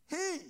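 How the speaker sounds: "he" lifted high and loud, set against "it"; stress on he. Shown as a demonstration. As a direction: land hard on "he". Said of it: "not it".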